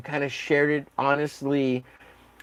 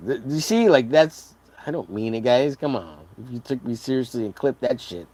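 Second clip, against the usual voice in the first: ordinary delivery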